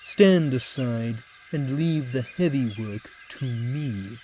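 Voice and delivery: haughty, self-important engine talking down; pompous engine. A narrator's reading. Proud engine belittling his small rivals, haughty. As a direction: look down your nose at the little engines.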